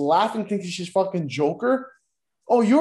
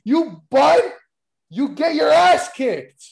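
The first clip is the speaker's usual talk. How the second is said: yelling, slow and much louder; angry shout; 6 semitones higher, 6 dB louder, slower than usual. Real, not acted. Ranting wrestling podcaster shouting at a wrestler. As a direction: bellow it like a furious fan. Ranting about line cutting.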